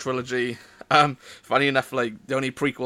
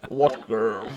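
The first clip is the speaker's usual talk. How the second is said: helmet voice changer. Real, not acted. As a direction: voice through a toy helmet. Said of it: "mixture of the sound effects".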